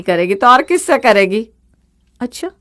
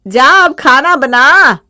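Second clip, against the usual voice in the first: louder, slow and firm; stern order; 3 semitones higher, 8 dB louder, much slower than usual. Acted. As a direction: hammer out the command. Mother-in-law orders daughter-in-law.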